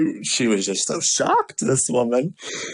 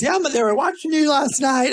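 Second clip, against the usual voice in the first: friend voiced high; 13 semitones higher than usual.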